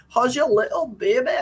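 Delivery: hammed-up, bad Scottish accent; terrible Scottish accent. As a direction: put on a clumsy Scottish accent. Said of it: "It's terrible Scottish accent".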